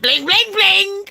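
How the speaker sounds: rising, high-pitched ringing noise; imitated phone alert. Telling how the phone went off, imitated high ring. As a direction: mimic a phone going off.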